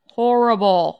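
loud. Real, not acted. Complaining about hair color.

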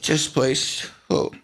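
halting, choked-up pauses; about to tear up. Acted. Guest remembers the men at the bar, getting choked up. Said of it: "I'm about to tear up".

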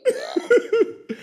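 high, loud yeah through a laugh; real amused laugh. Real, not acted. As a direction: giggle the word out. Laughing at the bad accent.

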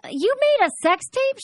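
high, slow astonished question; stunned at mom's confession; shocked disbelief. Retold or imitated. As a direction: gasp it out in disbelief. Shocked reply to mom.

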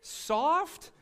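high, slowed, half-laughing whine at himself; mock bewildered crier. Retelling how he cried at a cartoon, high and laughing. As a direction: play yourself baffled by your own tears, laughing through it. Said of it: "and I'm going".

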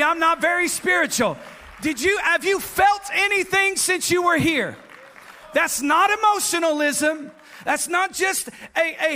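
loud, high, rising sermon shout; preaching at full force. Acted. Preacher shouting praise to the congregation, full force. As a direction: build the congregation up to a roar.

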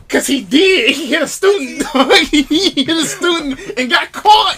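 loud, high, laughing revelation; laughing exclamation. Real, not acted. Friend revealing why the coach was caught, laughing loudly. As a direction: blurt it out loudly through laughter.

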